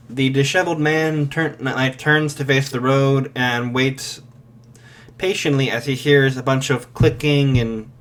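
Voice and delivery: ordinary delivery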